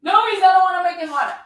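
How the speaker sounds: high, loud, fast mock protest; panicked student protest. Student begs the trainer not to make it harder, high and fast. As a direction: squeal out a rushed no.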